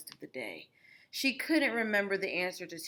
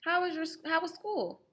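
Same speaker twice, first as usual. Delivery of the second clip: bright mother; 9 semitones higher, 4 dB louder than usual. Acted. Mama greeting.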